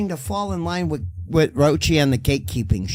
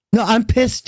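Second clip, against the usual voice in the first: pissed off; 6 semitones higher, 3 dB louder, slower than usual. Real, not acted. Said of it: "I'm getting emotional myself".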